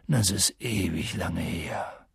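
slow, very low giant voice; brooding giant. A narrator's reading. Giant answering Harry about long ago, brooding. Hagrid recalls a dark past.

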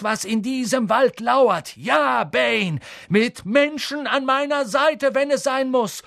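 rear up and defy him; angry defiance. A narrator's reading. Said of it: "plötzlich zornig".

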